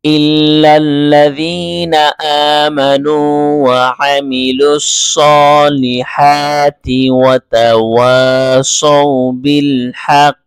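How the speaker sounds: slow, drawn-out chanted verse; Quranic recitation. Shown as a demonstration. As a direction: recite the full verse slowly and melodiously. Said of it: "আমি পূর্ণ আয়াতটি আবার পড়ছি". (পূর্ণ আয়াত ধীর সুরে তিলাওয়াত)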